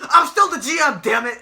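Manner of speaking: hammed-up burst of passion; Mick Foley. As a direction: imitate an authority figure losing it. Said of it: "so he starts screaming".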